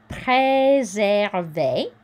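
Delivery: Préserver slow, é said as a clear A; careful French Préserver; French pronunciation. Shown as a demonstration. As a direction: sound out Préserver slowly in French. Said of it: "same A sound we've seen before".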